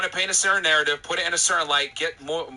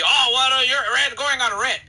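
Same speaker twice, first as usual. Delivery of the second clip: high, loud mocking whine; mocking imitation; 9 semitones higher, 5 dB louder than usual. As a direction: parody the caller's complaint, pitched up and louder. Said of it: "continuously try to tell me".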